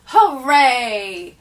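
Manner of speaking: loud joyful hooray; cheering. Acted. Cheer at end of poem.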